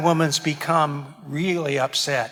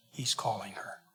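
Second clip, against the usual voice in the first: whisper; 5 semitones lower, 12 dB quieter than usual. Acted. Stressing the insult to her.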